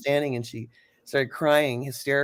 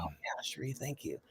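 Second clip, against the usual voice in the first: much softer, gentle gratitude; soft thanks; 7 semitones lower, 14 dB quieter than usual. Retold or imitated. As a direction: murmur a quiet thank you. Chelsea quoted, soft gratitude.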